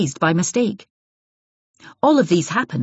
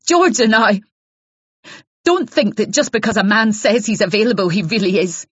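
loud, higher, raw accusing rant; hostile accusing wife; 4 semitones higher, 4 dB louder than usual. Acted. Jealous wife warns Isabel off her husband, raw and hostile.

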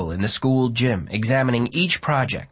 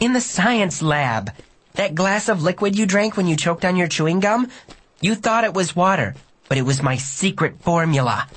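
put-on higher-pitched girl's voice; excited explaining girl; 6 semitones higher than usual. A narrator's reading. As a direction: explain it with self-satisfied emphasis. Narrator plays Megan.

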